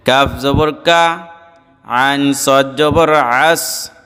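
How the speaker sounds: measured, level spelling chant with held endings; recitation drill. Shown as a demonstration. Teacher leading students letter by letter, measured recitation drill. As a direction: chant the spelling, then draw out the joined word.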